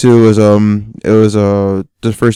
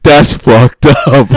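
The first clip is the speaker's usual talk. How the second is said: laughing quote; 3 semitones higher, 6 dB louder, much slower than usual. Retold or imitated. Quoting the neighbour amid laughter.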